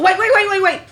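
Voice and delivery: high, quick, urgent repeats; imitating mom stopping her before she hangs up; mom's urgent call. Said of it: "and she goes".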